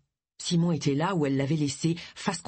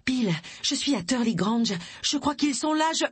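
high, urgent phone report; rising alarm, broken off mid-sentence; 8 semitones higher than usual. Acted. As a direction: report it urgently, pitch rising until cut off. Frightened urgent call, cut off.

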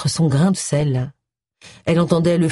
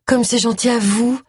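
higher-pitched, polite reply; restrained politeness; 6 semitones higher than usual. A narrator's reading. Vicar's wife answers Agatha on the phone, restrained politeness. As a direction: keep it sweet, holding back an urge to cry out. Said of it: "tout en réprimant une forte envie de crier".

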